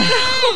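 loud high shriek of laughter. Real, not acted. Hearing dad used bleach, a loud shrieking laugh. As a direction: scream with laughter.

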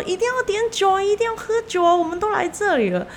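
high, pushy party voice; insistent nephew. Retold or imitated. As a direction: egg everyone on to drink. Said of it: "他就一直说".

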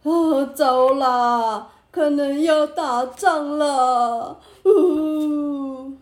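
slow, loud, sobbing lament; crying minister. A narrator's reading. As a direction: blubber out the bad news. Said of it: "换大臣哭了起来".

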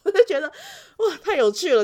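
high, loud laughing wow; laughing delight. Real, not acted. Teacher laughs recalling the cute pupil, loud and high. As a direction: laugh through the amazement. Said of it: "我实在是觉得太好笑".

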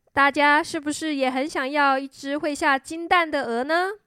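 usual speaking voice

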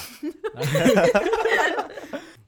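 high, loud embarrassed burst of laughter; nervous laugh. Real, not acted. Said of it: "啊，好尴尬。".

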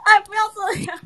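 high, whiny mock plea; hammed-up whining protest; mock whine. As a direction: whimper it like a wounded joke. Whining after being teased.

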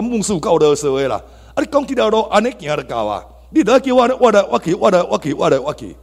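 high, loud whiny complaint fading down; mock the griping members, then let it sag; mock grumbling. Mimicking church members complaining about the pastor, loud whiny grumble.

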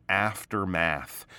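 "aftermath." with both A sounds stretched wide, slowly. Shown as a demonstration. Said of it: "That's how you get that big round A sign".

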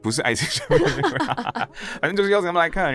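high, laughing denial; giggling, embarrassed denial. Real, not acted. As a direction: laugh through the words while protesting. Teased about the ex-girlfriend.

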